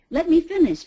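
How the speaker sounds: a hammed-up indignant retort; mock indignant snap. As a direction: cut back in, offended. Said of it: "what do they say?".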